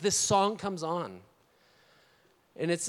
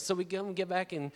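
ordinary delivery

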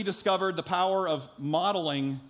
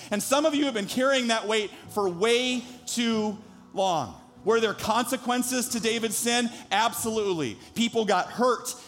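raised, higher preaching voice; 6 semitones higher than usual. Acted. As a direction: preach it to the whole room. Preaching to the congregation.

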